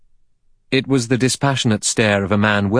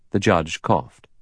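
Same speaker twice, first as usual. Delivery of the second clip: ordinary delivery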